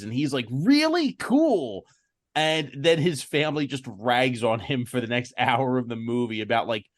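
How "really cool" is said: high, wide-eyed mock delight; excited kid. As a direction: pitch leaps up like a thrilled kid. Kid's delighted reply voiced.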